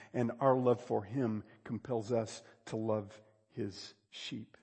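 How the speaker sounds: normal talking voice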